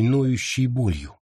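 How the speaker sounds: ordinary delivery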